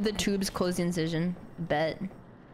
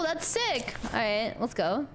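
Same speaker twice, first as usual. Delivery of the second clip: ordinary talk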